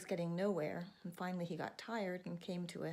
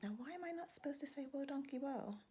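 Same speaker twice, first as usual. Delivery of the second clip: higher, softer puzzled musing; wondering blacksmith; 8 semitones higher, 3 dB quieter than usual. Acted. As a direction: muse it to yourself, curious. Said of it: "the blacksmith wondered".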